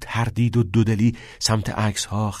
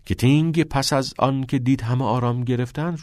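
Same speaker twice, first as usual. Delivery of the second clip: plain reading voice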